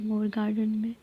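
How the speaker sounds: normal talking voice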